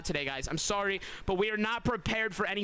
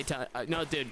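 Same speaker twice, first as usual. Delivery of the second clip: ordinary talk, nothing marked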